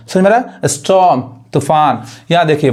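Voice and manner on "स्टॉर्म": स्टॉर्म as "es-tawm", no r; silent r. Shown as a demonstration. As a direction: drop the r in स्टॉर्म. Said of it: "आर नहीं पढ़ाता है".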